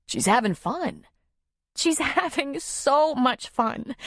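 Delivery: high, slowing, weepy voice; tearful sniffling. A narrator's reading. Nora explaining her tears over Barry, tearful. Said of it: "She sniffed".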